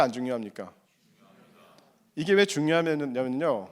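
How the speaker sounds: ordinary talk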